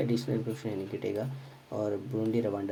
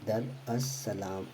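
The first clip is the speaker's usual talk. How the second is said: very slow, each syllable drawn out; slow spelled-out name; much slower than usual. Shown as a demonstration. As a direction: spell the name out slowly for students. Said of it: "उसको बोलते हैं हम दर". (धीरे-धीरे, हर अक्षर खींचकर)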